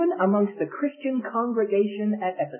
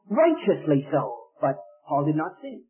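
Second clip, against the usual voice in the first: usual speaking voice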